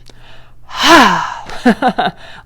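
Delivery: loud open-mouthed haa of release; long release sigh. Shown as a demonstration. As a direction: let it all go in one big audible sigh. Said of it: "It's like a".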